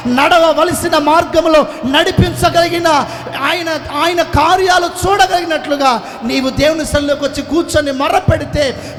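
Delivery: loud rolling sermon cadence, often shouting; fervent preaching. Acted. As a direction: preach it out to the whole hall. Preaching to congregation. (ఉద్వేగంతో గట్టిగా ప్రసంగం)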